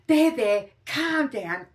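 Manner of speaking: high, loud condescending soothing; patronizing there there. Retold or imitated. Mocking someone who tells her to calm down, patronizing. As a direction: talk down to them, mock-soothing. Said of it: "as soon as someone goes".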